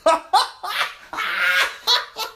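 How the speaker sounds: high, loud burst of cackling laughter; loud cackle. Retold or imitated. Boyfriend overhears the dad on speakerphone, loud cackle. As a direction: let a wild cackle rip. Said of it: "all you hear in the background is me going".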